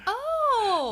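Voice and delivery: high, drawn-out "oh"; acted dawning realization; hindsight realization. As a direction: let the penny drop on one long high note. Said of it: "in hindsight going".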